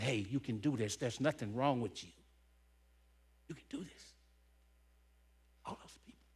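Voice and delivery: holding back tears as the voice drops away; tearful. Real, not acted. Remembering the people who believed in him, tearful and fading. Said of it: "You know why I'm crying?".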